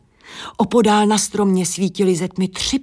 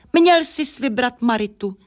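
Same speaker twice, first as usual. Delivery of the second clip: higher-pitched, mild exclamation; exclaiming; 6 semitones higher than usual. A narrator's reading. First owl correcting the merchant's choice, mildly exclaiming. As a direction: call it out lightly, pitched up. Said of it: "zvolala první sova".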